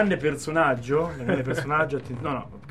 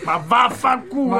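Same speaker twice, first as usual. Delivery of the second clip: loud, drawn-out, higher curse; exasperated outburst; 7 semitones higher, 5 dB louder, slower than usual. Real, not acted. Host reacts to the failed prank, loud exasperation. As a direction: burst out with a long exclamation.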